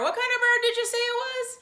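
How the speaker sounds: high, loud puzzled policeman. Acted. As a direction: ask it like a baffled cop. Policeman voiced in story.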